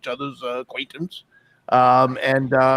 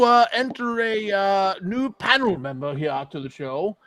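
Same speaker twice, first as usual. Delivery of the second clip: ordinary talk, nothing marked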